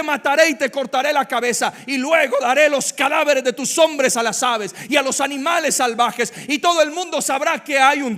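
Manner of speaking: loud, high proclamation of faith; bold defiant declaration. Acted. David answering Goliath, loud and defiant. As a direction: shout it like a battle cry. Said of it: "Mire cómo responde un niño".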